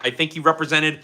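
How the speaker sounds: ordinary talk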